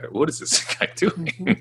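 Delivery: high, slow, puzzled mock voice with a laugh; baffled professor. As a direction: play the bewildered professor, laughing as you ask. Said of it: "And they're just like".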